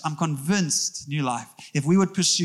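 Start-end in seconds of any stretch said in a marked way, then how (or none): 0.0-1.1 slowed emphasis. Real, not acted. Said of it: "repeated slower".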